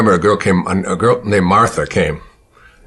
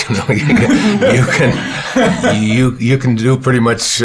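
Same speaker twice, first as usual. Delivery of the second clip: laughing through the words, pitched up; 5 semitones higher, slower than usual. Retold or imitated. Boy reassuring crying Martha, laughing. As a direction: chuckle as you reassure her.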